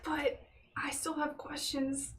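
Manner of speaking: slow secretive whisper. Acted. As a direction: whisper it slowly. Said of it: "Sleepover voices".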